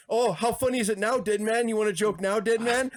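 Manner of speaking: high, gloating mock taunt; gloating Yokozuna taunt. Yokozuna sitting on Undertaker, high gloating mock taunt. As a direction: crow it up high at the dead man. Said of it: "And he's like".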